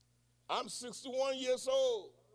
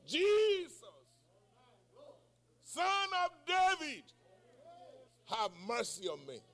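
desperate cry; 4 semitones higher than usual. Acted. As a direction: call out to Jesus for mercy. Blind man crying out.

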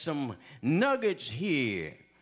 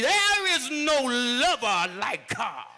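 full-force preaching; 6 semitones higher, 4 dB louder than usual. Acted. Preacher building to a climax.

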